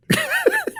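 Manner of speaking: a sudden high cackle breaking into the story; burst of laughter. Real, not acted. Laughing at his own road-rage tale.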